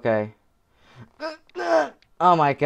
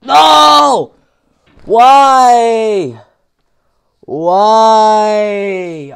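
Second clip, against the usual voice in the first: high, loud cry of dismay; frustrated shout; 4 semitones higher, 8 dB louder, much slower than usual. Real, not acted. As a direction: yell at the screen. Falls in game.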